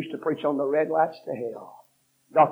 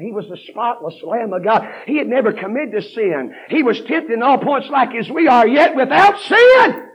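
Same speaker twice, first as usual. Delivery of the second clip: building preaching; 8 semitones higher, 11 dB louder than usual. Acted. Preacher praising the spotless Lamb, building high and loud. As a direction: climb to the peak.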